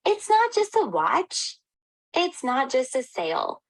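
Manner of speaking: NOT in "It's not just a watch" pitched high and stretched slowly; emphatic not. Real, not acted. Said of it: "emphatic contrast".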